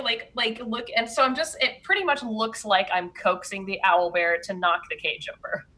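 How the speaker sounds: usual speaking voice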